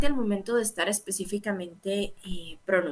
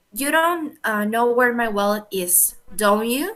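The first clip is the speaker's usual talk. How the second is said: tag "don't you?" lifted at the end, louder; rising intonation; 6 dB louder, slower than usual. Shown as a demonstration. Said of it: "ahí nos dice rising".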